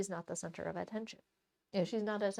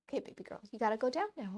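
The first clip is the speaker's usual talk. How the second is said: ordinary talk, nothing marked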